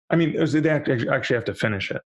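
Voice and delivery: ordinary talk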